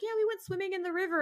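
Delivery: high, quick airy imitation of friends; mocking friend voice. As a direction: toss it off like a carefree friend. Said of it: "they're like".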